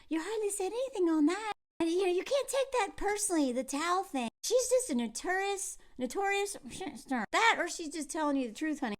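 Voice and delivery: smooth it over for him sweetly; soothing girlfriend. Retold or imitated. Girlfriend reassures her pouting boyfriend, soft and sugary.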